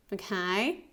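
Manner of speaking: normal talking voice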